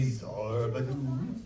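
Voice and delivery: slow, drawn-out incantation; magic word. Acted. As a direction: stretch the magic word out like a spell. Magician says his magic word.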